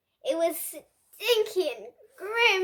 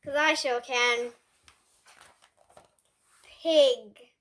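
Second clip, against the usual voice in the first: ordinary delivery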